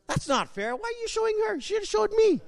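high mock whine; whiny complaint. Preacher jokingly complaining to God, high whiny voice. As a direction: pretend sulking at God.

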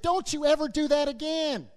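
lightly acted parental scolding; mad mother. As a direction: imitate an angry mother's telling-off. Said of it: "She was mad. She's like".

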